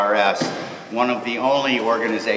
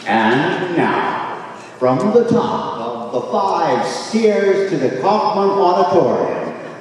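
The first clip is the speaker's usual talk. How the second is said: louder, slow, drawn-out showman introduction; presenting the orchestra to the crowd, booming; grand stage announcer; 5 semitones higher, 5 dB louder than usual. Acted. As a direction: announce it like a ringmaster, booming and stretched.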